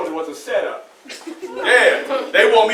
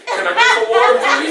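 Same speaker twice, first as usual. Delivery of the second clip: mock stranger asking; 8 semitones higher, 6 dB louder than usual. Street person asking for water.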